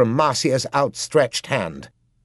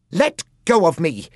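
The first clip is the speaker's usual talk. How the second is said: a sharp, higher yell; 7 semitones higher, 5 dB louder than usual. A narrator's reading. Girl yelling at the assassin who grabbed her. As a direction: shout it to break free of the grip. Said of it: "she yelled".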